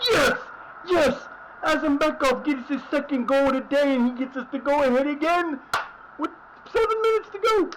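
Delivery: screaming, high and loud with joy; ecstatic goal yell. Real, not acted. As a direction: shout the goal news in triumph. Goal celebration.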